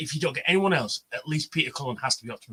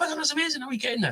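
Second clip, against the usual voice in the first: put-on much higher, gushing girlfriend voice; excited girlfriend; 12 semitones higher, much faster than usual.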